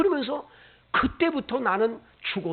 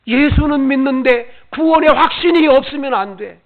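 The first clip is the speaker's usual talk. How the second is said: loud, higher urgent pleading to a wife; urgent plea; 6 semitones higher, 12 dB louder than usual. Retold or imitated. Husband urging his wife about salvation, loud and urgent. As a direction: press it on her as a matter of life and death.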